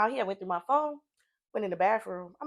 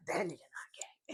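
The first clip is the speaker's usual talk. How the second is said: whispered quote; 5 semitones lower, 15 dB quieter than usual. Retold or imitated. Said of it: "He like".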